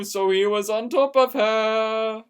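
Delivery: plain reading voice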